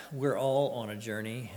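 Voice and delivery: ordinary delivery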